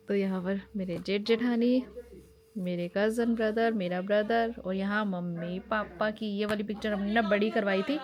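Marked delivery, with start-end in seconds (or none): none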